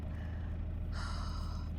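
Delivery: weary sigh. Acted. Weary in pain.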